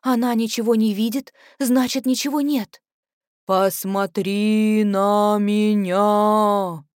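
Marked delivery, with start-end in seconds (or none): 3.4-6.8 very slow, words forced out and choked; choking monster voice. Acted. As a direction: push each word out like it hurts. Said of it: "выталкивает из себя слова, давится ими". (чудовищный голос, давится словами)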